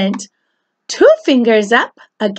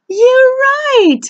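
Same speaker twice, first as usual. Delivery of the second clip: praise; 12 semitones higher than usual. Real, not acted. Praising child.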